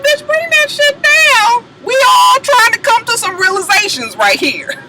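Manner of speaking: high hammed-up scold; mock scolding. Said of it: "I was like".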